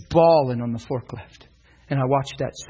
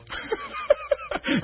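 laugh. Real, not acted. Laughing at own story.

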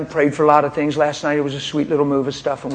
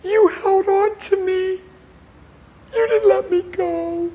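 much higher, slow, choked with emotion; wife's tearful realisation; 3 dB louder, slower than usual. Retold or imitated. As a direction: voice her overwhelmed wonder. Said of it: "She said".

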